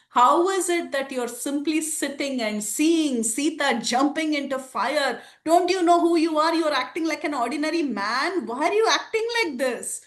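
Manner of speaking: high, incredulous protest; can't believe what they're seeing; astonished demigods. Acted. Demigods plead with Ram from the sky, high and astonished.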